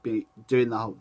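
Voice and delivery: normal talking voice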